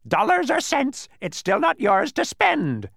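high, loud, haughty squawk; haughty shrieking bird voice. A narrator's reading. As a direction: lord it over them in a bird screech. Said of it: "the bird replied haughtily".

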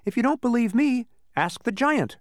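high-pitched, lively character voice; Alec, confident explainer. A narrator's reading. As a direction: explain it high and sure of yourself. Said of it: "when Alec said".

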